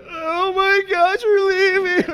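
high, slow crying voice breaking; retold tearful wail. Husband at the airport sign, high, crying voice. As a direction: replay the moment you fell apart, sobbing. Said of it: "I start losing it".

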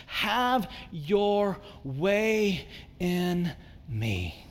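very slow, drawn-out open-handed surrender; surrender prayer. Shown as a demonstration. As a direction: let each word out slowly, hands open to God. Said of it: "this gesture of your hands open and go".

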